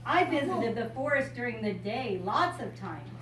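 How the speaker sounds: bright storybook reading voice; reading to a child. Acted. Grandmother reading a picture book to a child, bright storybook voice. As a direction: read it out like a picture book for a little one.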